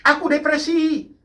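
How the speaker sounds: high-pitched mock outcry; imitated mothers' cry. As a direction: cry out like a distressed mother, hammed-up. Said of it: "Kadang-kadang ibu-ibu teriak".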